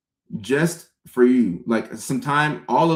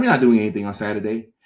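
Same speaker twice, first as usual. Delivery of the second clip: ordinary talk, nothing marked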